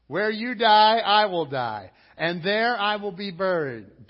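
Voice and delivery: loud, high-pitched declaration of loyalty; forceful vow. Acted. Ruth vowing loyalty to Naomi, forcefully proclaimed. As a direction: proclaim it at full preaching force. Preacher voices Ruth's solemn vow.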